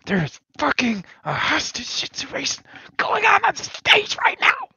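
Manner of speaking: urgent whisper into the comms. Acted. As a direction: whisper it like you're hiding from someone. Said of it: "whisper into the comms".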